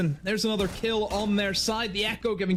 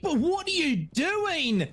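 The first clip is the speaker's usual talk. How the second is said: incredulous; 7 semitones higher, slower than usual. Real, not acted. Caster baffled by a risky play.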